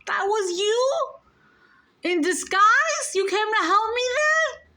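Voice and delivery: overwhelmed, incredulous cry to Jesus; tearful disbelief. Retold or imitated. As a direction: pitch soaring as the realization hits. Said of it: "And I start crying. I'm like".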